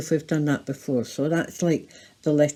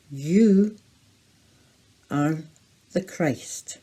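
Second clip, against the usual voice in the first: slow, spaced words with pauses; slow signing recital; 4 dB louder, slower than usual. Shown as a demonstration. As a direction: read out slowly, word by word, for signing. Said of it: "sign that together".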